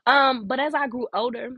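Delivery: normal talking voice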